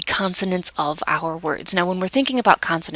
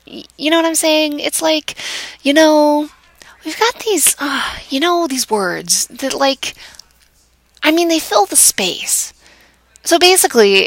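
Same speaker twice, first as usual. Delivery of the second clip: higher-pitched, halting demo of verbal fillers; exaggerated hesitant chatter, pitched up; silly filler-laden rambling; 7 semitones higher, 4 dB louder than usual. Shown as a demonstration. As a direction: ham up the groping for words, hanging on each filler. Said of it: "I'm being a little bit silly there".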